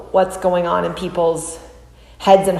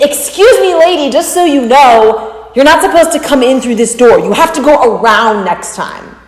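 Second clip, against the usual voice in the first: high, loud, sharply reproachful woman; upset scolding; 6 semitones higher, 6 dB louder than usual. Retold or imitated. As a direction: scold her for using the wrong door. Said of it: "She was very upset about it".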